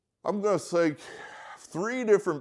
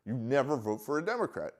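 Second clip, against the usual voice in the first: ordinary talk, nothing marked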